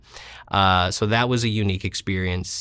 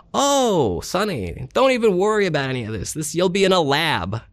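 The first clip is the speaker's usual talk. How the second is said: put-on older New York professor joking, a bit higher; 8 semitones higher than usual. Professor teasing the student about his major, light New York accent. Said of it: "he said it in his accent".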